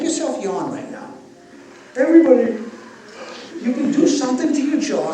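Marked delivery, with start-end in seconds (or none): 2.0-5.1 loud call to the whole room. Real, not acted. Speaker to audience, a loud call. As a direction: project to everyone in the hall.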